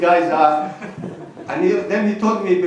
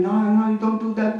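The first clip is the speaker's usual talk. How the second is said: higher-pitched scolding, a mild mock shout; half-hearted retold yell, pitched up; 5 semitones higher than usual. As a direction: imitate an imam telling someone off, raised pitch. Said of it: "Shouting at me when I was praying".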